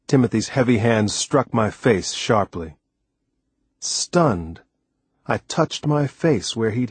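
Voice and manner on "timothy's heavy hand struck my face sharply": plain reading voice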